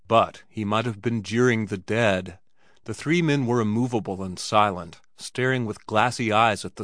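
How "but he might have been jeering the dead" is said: ordinary delivery, nothing marked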